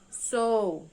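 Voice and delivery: "sou" loud, standing alone; segunda sílaba isolada. Shown as a demonstration. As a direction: stretch "sou" out as its own syllable. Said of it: "Elas vão se separar". ("sou" forte, como sílaba separada)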